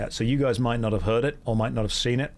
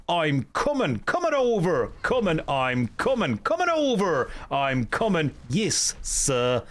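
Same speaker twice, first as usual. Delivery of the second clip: mock Green Beret calling back orders; Green Beret game voice; 3 semitones higher, slower than usual. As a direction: parrot repeated game unit responses in a put-on accent. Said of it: "all my mum hears coming from my laptop is".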